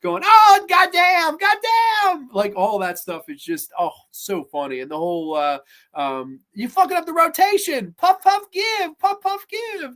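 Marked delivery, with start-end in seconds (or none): 0.2-2.3 high, loud, drawn-out mock yell; Chris Tucker freaking out. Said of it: "Chris Tucker freaks out". 6.5-9.9 high-pitched comic scolding; hammed-up high squeal of a complaint; imitated movie line. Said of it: "it's the way the lines are delivered".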